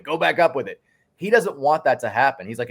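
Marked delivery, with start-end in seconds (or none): 0.0-0.8 lighter coach echo. Retold or imitated. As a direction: echo the shout, less forcefully. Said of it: "would just shout".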